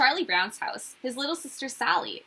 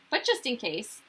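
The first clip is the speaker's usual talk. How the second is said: ordinary delivery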